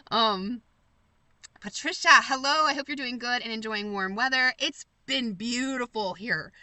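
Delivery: ordinary delivery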